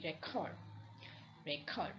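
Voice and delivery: record stressed on the second syllable, re-CORD; back-stressed record, full second syllable; verb stress on record. Shown as a demonstration. Lecturer showing the word as a verb, record stressed on the second syllable. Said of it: "use it as a verb".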